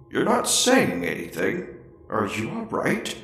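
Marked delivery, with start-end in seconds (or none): none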